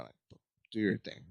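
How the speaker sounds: normal talking voice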